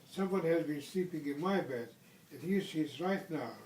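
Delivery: plain reading voice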